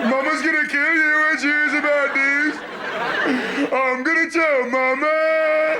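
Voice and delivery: put-on high, drawn-out kid wail; whining little brother. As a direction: whine like a tattling little brother. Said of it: "all I heard all day was".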